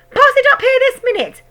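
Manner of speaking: very high, loud hag shout. A narrator's reading. Grandma shouting at George, very high and loud. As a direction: bark at the boy.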